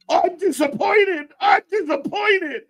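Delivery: high, slow mock dad scolding; mock disappointed dad. Mocking Jericho's dad speech, high slow mock scolding. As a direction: imitate a let-down father. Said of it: "the angry dad speech".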